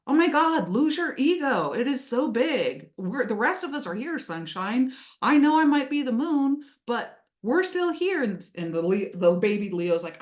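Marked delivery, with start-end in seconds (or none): 0.0-8.2 exasperated. Pretend. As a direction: roll your eyes at the big ego. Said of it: "Or you could go".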